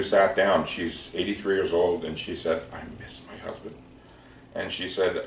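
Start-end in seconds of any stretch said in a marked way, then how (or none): 2.7-3.7 breathy whisper, much softer; whispered confession. Retold or imitated. Retelling an old widow's words to him, whispered. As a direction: let it slip out as a hushed secret. Widow confides her grief.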